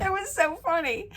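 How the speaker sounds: high, squeaky with leftover laughter; real laughter. Real, not acted. Recalling friend's mishap on the boat, high and giggly. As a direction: still giggling as you say it.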